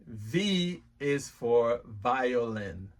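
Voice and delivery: V is for violin said very slowly and clearly; slow model phrase. Shown as a demonstration. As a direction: stretch each word out for a learner. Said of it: "repeat after me".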